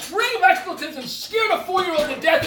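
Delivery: high-pitched, heated accusation; real angry screaming. Real, not acted. Parent scolding over a scared four-year-old, angry screaming. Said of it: "if you're gonna scream it at me".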